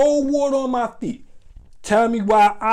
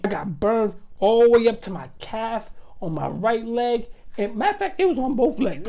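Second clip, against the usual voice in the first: normal talking voice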